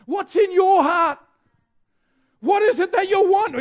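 loud challenge. Real, not acted. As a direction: press the church to answer. Direct challenge to congregation.

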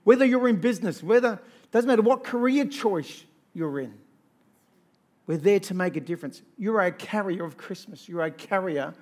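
loud, rising sermon call; rally the congregation, voice ringing; fervent preacher building up the crowd; preaching at full force. Acted. Sermon exhortation.

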